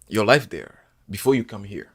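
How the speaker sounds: normal talking voice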